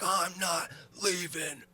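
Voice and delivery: put-on high, slow stubborn drunk whine; drunk defiance. Drunk teen refusing to leave the bus, slow and high.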